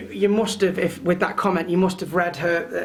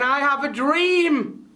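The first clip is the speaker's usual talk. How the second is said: higher, slow King-style delivery; slow, lifted oratory; 6 semitones higher, much slower than usual. Retold or imitated. A coach imitating King's famous line, slow and lifted. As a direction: stretch each word like a great orator. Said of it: "He went".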